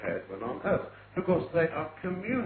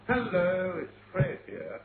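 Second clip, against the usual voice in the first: lightly acted phone greeting, higher and louder on hello; phone caller; 6 semitones higher, 3 dB louder, slower than usual. Someone rings up on the telephone, lightly acted caller. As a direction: announce yourself down the telephone. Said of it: "somebody may ring you up".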